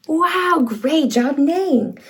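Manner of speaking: ordinary delivery, nothing marked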